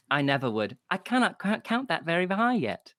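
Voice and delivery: put-on a small girl owning up to the barkeep; little girl apologizing for the bird.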